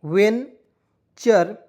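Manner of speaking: Venture stretched very slowly; slow classroom model of venture; drawn out. Shown as a demonstration. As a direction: spell out venture syllable by syllable. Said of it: "वेन नहीं लिखेंगे".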